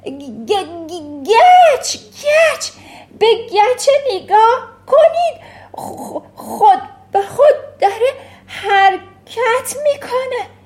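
put-on boy shrieking at the moving chalk; excited stuttering shout. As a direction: point and yell in wild alarm. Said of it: "هیجان زده ... فریاد کشید".

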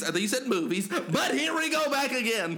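high, loud, hyped host voice; show outro. Acted. Hosts signing off the show to listeners, loud and hyped. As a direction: hype the outro and promo with energy.

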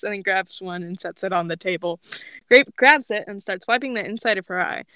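usual speaking voice

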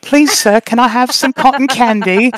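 high pleading street urchin voice; Dickensian orphan. Retold or imitated. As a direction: beg like a ragged little orphan. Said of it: "the most brutal Dickensian orphan".

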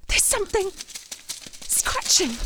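breathless, slow whisper after a gasp; frightened whisper. Acted. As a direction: whisper it so the thing can't hear you. Hears a noise in the dark.

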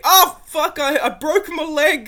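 high, loud hammed-up excitement to pain; playful fake cheer then fake injury cry; mock excitement. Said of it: "I'm just kidding".

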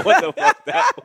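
laughing through the words; cracking up mid-sentence. Real, not acted. Friend laughing at a botched accent, words broken by laughter.

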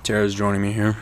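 usual speaking voice

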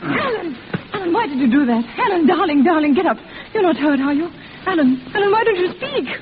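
tearful, panicky pleading; frightened. Acted. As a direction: call to him in alarm. Alan jumps from window.

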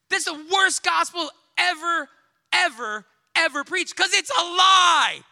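shouted. Real, not acted. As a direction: slam it down as a hard truth. Preacher denouncing false gospel.

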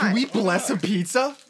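slow, eager question; excited. Real, not acted. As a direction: ask it with delight.

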